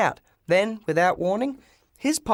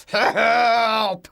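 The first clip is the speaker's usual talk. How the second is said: long, louder cry for help; desperate call for help; 5 semitones higher, 5 dB louder than usual. Acted. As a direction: call out trapped under the junk. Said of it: "came a deep voice from inside".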